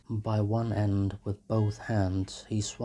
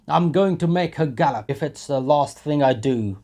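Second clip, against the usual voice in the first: loud, high, swaggering shout; drunken owner shouting down the bystanders; drunken bully; 6 semitones higher, 13 dB louder than usual. A narrator's reading. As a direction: bark him off, then rally the crowd. Drunk owner defends beating horse.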